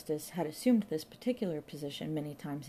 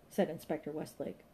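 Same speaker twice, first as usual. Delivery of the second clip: ordinary delivery, nothing marked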